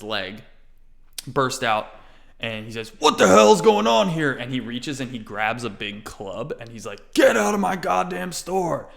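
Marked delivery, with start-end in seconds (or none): 3.0-4.3 high loud angry demand; angry shopkeeper. Acted. Shopkeeper bursting in at the noisy customers, shouting. 7.1-8.9 angry order. Acted. Shopkeeper grabs club.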